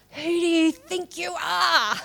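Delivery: high, drawn-out mock yell; retold yell. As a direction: yell it slowly at the sky, hammed-up. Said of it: "I yelled out loud".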